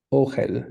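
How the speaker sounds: ojel as OH-hel, first syllable stressed; Hebrew pronunciation. Shown as a demonstration. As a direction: say ojel with a long O, then hel. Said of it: "so it's gonna be".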